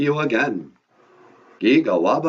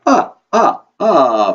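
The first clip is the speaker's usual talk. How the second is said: loud, high staccato vampire laugh; booming triumphant "ah, ah, ah" laugh; Count's laugh; 3 semitones higher, 7 dB louder, much slower than usual. Acted. Count delighted with his counting, loud staccato vampire laugh. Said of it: "You don't have to laugh after everything you say".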